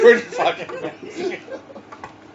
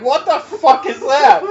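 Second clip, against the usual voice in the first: laughing retold question; 8 semitones higher, 4 dB louder than usual. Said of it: "I'm like".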